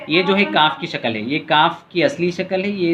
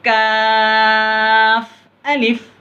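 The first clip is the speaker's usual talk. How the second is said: slow, high, louder chanted lam alif; drawn-out recitation; 6 semitones higher, 4 dB louder, much slower than usual. Shown as a demonstration. Teacher demonstrates the lesson's letters to pupils, slow chanted and louder. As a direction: hold लाम very long, then a falling अलिफ. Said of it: "काफ से हरफी था".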